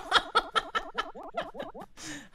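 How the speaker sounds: long, high, soft giggle; real giggling. Real, not acted. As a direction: giggle on and on at your own silliness. Laughs at own mistake.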